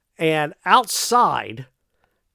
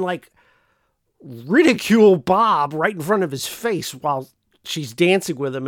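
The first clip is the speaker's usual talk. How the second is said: ordinary talk, nothing marked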